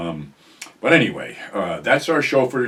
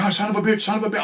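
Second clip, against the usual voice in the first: rattled cursing; 12 semitones higher, much faster than usual. Retold or imitated. Friend jumps in, cursing.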